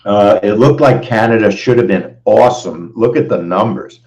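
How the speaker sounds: ordinary delivery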